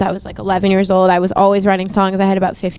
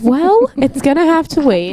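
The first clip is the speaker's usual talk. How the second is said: higher, slow, airy brush-off; breezy dismissal; 6 semitones higher, slower than usual. Retold or imitated. Teen star telling friends schoolwork can wait, airy and slow. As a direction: wave off homework like a busy executive. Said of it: "and I'm like".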